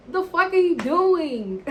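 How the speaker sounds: loud, high, drawn-out retort; indignant protest. Retold or imitated. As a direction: escalate into a loud, stretched-out mock outburst. Climax of acted protest at hand.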